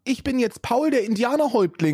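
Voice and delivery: proud mock chief announcement; mock Paul Ronsheimer. Host retelling the reporter's stunt, high hammed-up voice.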